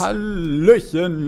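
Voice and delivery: drawn-out greeting. Real, not acted.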